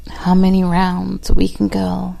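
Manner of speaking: loud, intimate spoken-word reading; poem recital. Acted.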